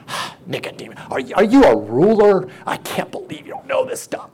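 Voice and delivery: high, sneering disbelief in a put-on voice; Jesus mocking Nicodemus, a tone the preacher rejects; mocking incredulity. Shown as a demonstration. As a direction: scoff at him like he should know better. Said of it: "I often wonder about the tone".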